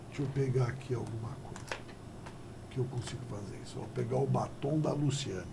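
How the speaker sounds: quiet unvoiced whisper to herself; hushed whisper off to the side; whispered aside. Real, not acted. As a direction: murmur it under the breath as an aside. Aside while fetching a marker.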